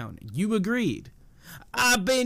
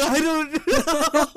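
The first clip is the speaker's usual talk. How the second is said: laughing; 10 semitones higher, 3 dB louder, much slower than usual. Retold or imitated. Exasperated quote, laughing.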